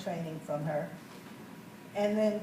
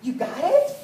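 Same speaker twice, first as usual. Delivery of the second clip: high, loud disbelief; can't believe she got it; surprised; 9 semitones higher, 6 dB louder than usual. Retold or imitated. Telling her neighbor, high and loud in disbelief.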